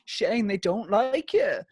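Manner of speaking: high, slow mock bad-news voice; Louis Walsh. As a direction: break it slowly in a high put-on voice. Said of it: "he's like".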